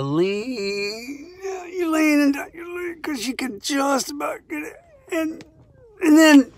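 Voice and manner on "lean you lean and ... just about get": high, strained stretching voice; straining reach. Retold or imitated. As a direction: stretch further and further for the pick. Reaching for dropped pick, straining.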